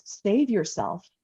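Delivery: ordinary talk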